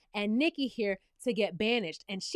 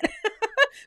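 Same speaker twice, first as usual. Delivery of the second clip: amused laugh; 9 semitones higher, 3 dB louder than usual. Real, not acted. Cracking up at the scene.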